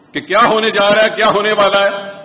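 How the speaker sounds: preacher's rising outcry; alarmed warning. Real, not acted. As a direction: rouse them to alarm. Warning the congregation.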